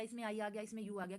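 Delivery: normal talking voice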